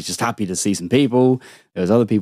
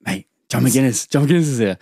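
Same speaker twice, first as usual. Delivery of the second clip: urgent alert; 3 semitones higher than usual. Retold or imitated. Urgently alerting his mate.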